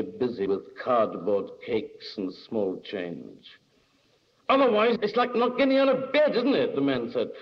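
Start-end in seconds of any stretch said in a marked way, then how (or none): 4.5-6.7 high, loud, insistent protest; heated arguing. A narrator's reading. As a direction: argue it hard, voice pitched right up. Man argues, temper rising.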